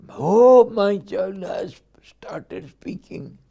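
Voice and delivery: put-on thrilled at the baby's first words; delighted parent. Proud parent marvelling that the child speaks, delighted. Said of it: "parent delighted by child".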